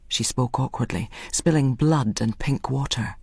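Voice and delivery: ordinary delivery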